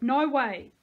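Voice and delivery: emphatic narrated shout; yelled. A narrator's reading. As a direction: shut them down with a yell. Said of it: "Missus Woolley yelled".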